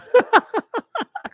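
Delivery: short loud laugh, pitched high; high burst of laughter. Real, not acted. Laughs after own joke.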